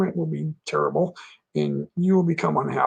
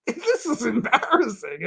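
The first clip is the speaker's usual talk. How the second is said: laughing embarrassment; 6 semitones higher than usual. Retold or imitated. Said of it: "looking at the women going".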